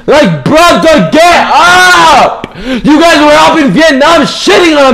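loud, high furious yelling; raging shout. Real, not acted. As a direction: scream at the game in real rage. Raging at being stuck down.

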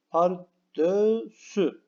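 Pardösü said very slowly, stressing the ö; slow careful. Shown as a demonstration. Teacher to pupils, very slow Pardösü. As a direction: draw out Pardösü to show the ö. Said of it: "buradaki ö sesi".

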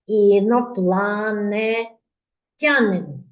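Інопланетянин stretched very slowly; word spelt out clearly. Shown as a demonstration. Class repeats with teacher: Інопланетянин slowly. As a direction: sound out Інопланетянин one syllable at a time. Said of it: "Повторіть разом зі мною".

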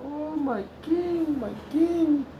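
oh my king, lifted high and slow; high slow cry. Real, not acted. Preacher crying out longingly for his returning king.

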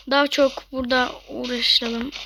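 ordinary delivery

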